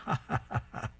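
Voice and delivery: staged villain's chuckle; acted laughing. A narrator's reading. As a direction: read out Darth's laugh, hammed-up. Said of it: "starts laughing".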